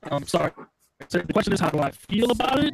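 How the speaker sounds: choppy dropping-out mic audio; like a bad connection cutting out; breaking up. Real, not acted. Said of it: "You're still breaking up".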